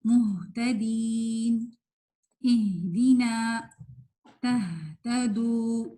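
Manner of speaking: slow recitation, breathed ہ in مہتدین، اہدینا، تہتدو; tajweed demo of ہا. Shown as a demonstration. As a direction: let air flow on each ہ of مہتدین، اہدینا، تہتدو. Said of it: "اسی طرح". (مہتدین، اہدینا، تہتدو میں ہ کھول کر ٹھہری ہوئی قرات)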